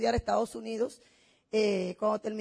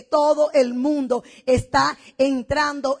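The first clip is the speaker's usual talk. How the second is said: "todo el mundo está entrando" spaced out slowly, word by word; slow emphatic declaration; 6 dB louder, slower than usual. Shown as a demonstration. Speaker teaching the audience "todo el mundo está entrando", slow and emphatic. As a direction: drive home "todo el mundo está entrando", each word separate and firm.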